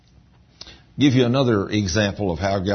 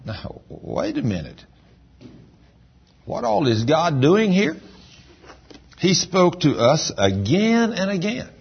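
preaching; 6 semitones higher than usual. Acted. Preaching climax.

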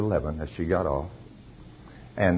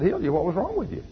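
astonished; 10 semitones higher than usual. Retold or imitated. Astonished at her healing news.

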